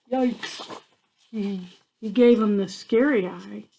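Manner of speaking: ordinary delivery, nothing marked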